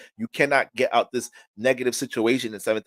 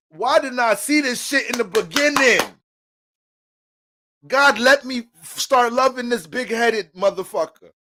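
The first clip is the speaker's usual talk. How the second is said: exasperated lover; 9 semitones higher, 4 dB louder than usual. Retold or imitated. Venting as a frustrated lover.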